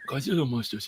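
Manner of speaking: put-on childlike wonder. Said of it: "standard childlike wonder voice".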